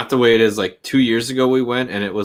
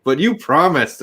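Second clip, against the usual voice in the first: put-on whiny kid; 7 semitones higher, 3 dB louder than usual. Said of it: "He's like".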